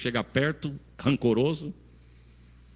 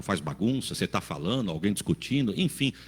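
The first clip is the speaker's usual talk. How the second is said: normal talking voice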